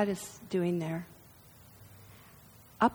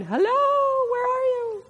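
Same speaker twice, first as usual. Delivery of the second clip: high, far-off calling giant voice; booming giant God; 13 semitones higher, 4 dB louder than usual. Acted. Giant God calls for hiding people, high calling. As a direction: call out high like a huge giant searching. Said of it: "feet in the garden going".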